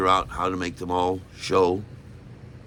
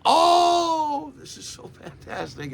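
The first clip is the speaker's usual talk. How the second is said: gushing delight; slower than usual. Retold or imitated. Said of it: "And I'm like".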